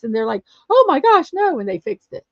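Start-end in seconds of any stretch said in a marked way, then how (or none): 0.7-1.6 high, loud startled gasp of a nurse; alarmed exclamation. Retold or imitated. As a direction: blurt it out in sudden alarm.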